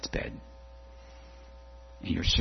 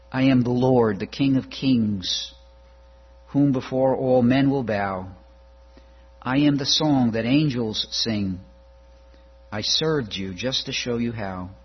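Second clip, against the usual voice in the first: louder, rolling sermon litany; rising preaching cadence; 3 semitones higher, 5 dB louder than usual. Acted. Preacher proclaiming Jesus's names to the congregation, rising fervor. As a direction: proclaim each I am with growing force.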